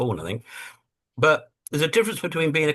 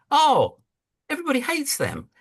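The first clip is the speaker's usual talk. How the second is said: mock boss; 14 semitones higher than usual. The big man discovering Intel's reputation, mock surprised. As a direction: blurt it in mock shock. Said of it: "the big man says".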